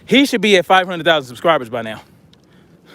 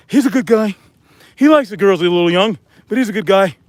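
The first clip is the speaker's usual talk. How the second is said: Donald Trump; 2 semitones higher than usual. Acted. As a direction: badly imitate a politician, pitched up. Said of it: "Trump voice like some people".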